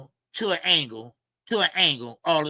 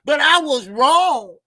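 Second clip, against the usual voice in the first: high, loud, slow mock confession; exaggerated mock-remorse voice, pitched up; mocking Tim Bradley; 8 semitones higher, 7 dB louder, slower than usual. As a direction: ham up a tearful apology, drawn out. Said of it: "Tim Bradley came out and said".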